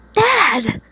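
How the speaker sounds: acted scream across a distance; screamed call. A narrator's reading. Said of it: "he screamed, his voice almost echoing".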